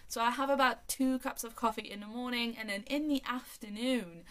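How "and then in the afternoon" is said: normal talking voice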